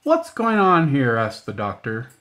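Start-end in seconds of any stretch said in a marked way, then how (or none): 0.0-1.2 demanding. Acted. Doctor bursts in on commotion.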